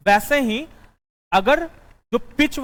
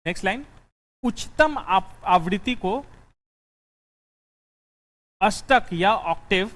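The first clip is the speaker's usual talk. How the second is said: dictating notes. Acted.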